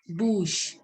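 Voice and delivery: "bouche" ending in a sh sound; French pronunciation. Shown as a demonstration. Teacher teaching French for mouth, pronunciation model. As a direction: model "bouche" as "boosh", falling at the end. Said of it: "So mouth is pronounced as".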